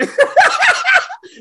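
shrieking high burst of laughter. Real, not acted. Host cracking up at the rice story, high laugh.

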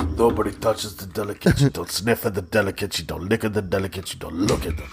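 put-on Russian accent. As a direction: warn them off like a Russian dealer. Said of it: "His delicates".

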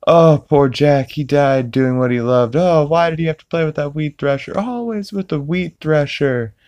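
hammed-up lament for a lost friend; imitating Peter mourning. Dad mourning his friend Jack, high mock grief. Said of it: "He's like".

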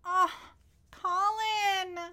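high delighted squeal of a name; excited squeal. Real, not acted.

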